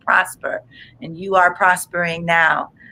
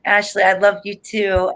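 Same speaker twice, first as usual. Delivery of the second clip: ordinary talk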